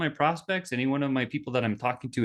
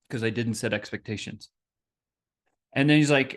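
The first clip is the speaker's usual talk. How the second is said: normal talking voice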